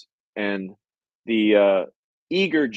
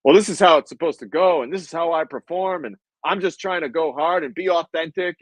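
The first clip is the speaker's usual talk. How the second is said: aggressive pushback; 6 semitones higher than usual. Retold or imitated. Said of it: "got aggressive back to Tony".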